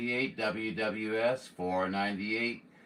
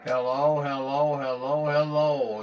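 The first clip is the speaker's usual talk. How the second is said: raised voice, hailing over the radio; calling out; 4 semitones higher, 5 dB louder than usual. Real, not acted. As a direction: call out to anyone listening. Radio operator calling out for contact.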